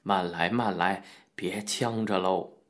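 soft, slow caution; slow him down kindly; gentle restraint. A narrator's reading. Lin gently stops the boy gulping wine, soft and slow. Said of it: "制止道".

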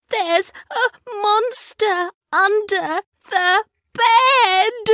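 put-on high, crying child's voice; sobbing. A narrator's reading. Kipper telling Mum and Dad, sobbing. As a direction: sob it out to his parents. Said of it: "he sobbed".

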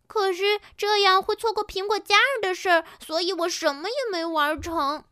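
high-pitched, whiny dismay; dejected. A narrator's reading. New Pinky missed all the fun, dejected and whining. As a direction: complain in high-voiced disappointment. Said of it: "新碧琪沮丧地说".

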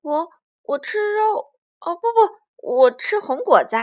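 loud, stammering cover-up; T-rex catching himself and lying loudly; flustered lie. Acted. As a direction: blurt the truth, then panic to hide it. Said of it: "不由得撒了个谎".

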